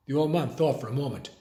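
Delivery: ordinary delivery, nothing marked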